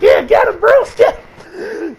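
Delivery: high, loud shouting cheer; excited cheering. Real, not acted. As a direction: yell it like a fan at a fight. Cheering Batman on in fight.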